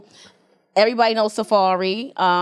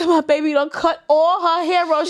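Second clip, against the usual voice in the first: shocked; 8 semitones higher than usual. Retold or imitated. Seeing her daughter's cut-off hair, shocked. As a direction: gasp it out in disbelief.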